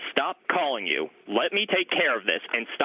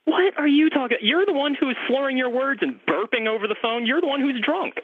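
exasperated disbelief; 12 semitones higher than usual. Real, not acted. Pharmacist snaps back at caller.

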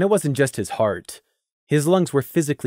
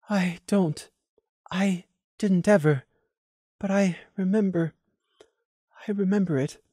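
stuttering blankly; 8 semitones higher than usual. Acted. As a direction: stammer it out, dazed. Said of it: "she stuttered blankly".

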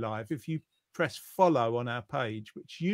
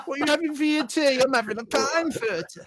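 high, loud hammed-up Geordie; mock Geordie accent; 10 semitones higher, 5 dB louder than usual. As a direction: put on a jokey Geordie accent, laughing. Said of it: "gonna do a Geordie accent".

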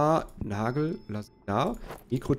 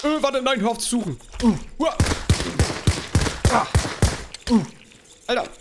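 panicked; 13 semitones higher, 5 dB louder than usual. Real, not acted. Chased by enemy in game.

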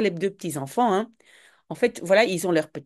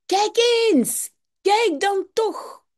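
put-on high, eager children calling; two little kids squealing at toys; excited calling out; 13 semitones higher, slower than usual. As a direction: call out in delight like small kids. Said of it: "En ze roepen".

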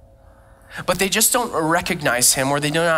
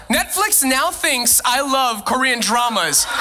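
normal talking voice

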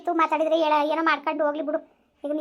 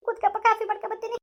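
crying; 7 semitones higher than usual. Acted. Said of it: "ನೀನು ಅಳ್ಬೇಡ".

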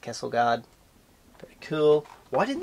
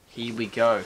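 normal talking voice

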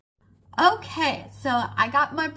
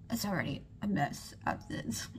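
whispering; 4 semitones lower, 10 dB quieter than usual. Real, not acted. Muttered aside about the mess.

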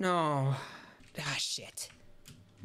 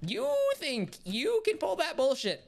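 mock outrage; 12 semitones higher than usual. Real, not acted. Scolding the game after failing.

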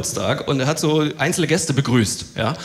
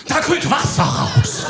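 awed friend; 3 semitones higher, 4 dB louder, much slower than usual. Retold or imitated. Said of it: "aufgeregt wie sonst was".

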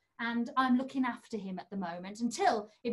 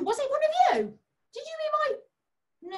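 mock surprise; 14 semitones higher, 5 dB louder than usual. Handkerchief vanished, mock accusing.

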